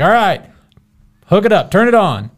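mild imitated holler to the wife; retold yell. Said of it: "I yell up at my wife".

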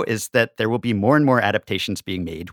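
ordinary delivery

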